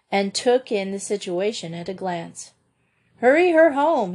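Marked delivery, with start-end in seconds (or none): 3.2-4.1 called out louder and higher; doctor calling out an urgent order; urgent cry. Acted. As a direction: hurry them along with a raised call. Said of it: "he cried".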